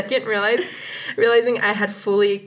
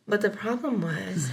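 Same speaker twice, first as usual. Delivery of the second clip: sexy voice; 2 semitones lower, 8 dB quieter than usual. Pretend. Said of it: "I'm gonna try to do my sexy voice".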